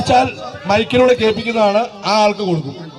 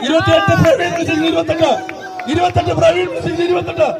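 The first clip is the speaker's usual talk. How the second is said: auctioneer; 6 semitones higher than usual. Acted. Rising bids called fast.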